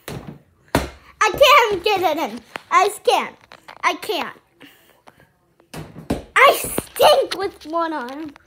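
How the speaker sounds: loud, slow, exasperated complaints; venting at being stuck with one arm; frustrated outburst. Real, not acted. Kid vlogger, fumbling one-handed, loud frustrated wail.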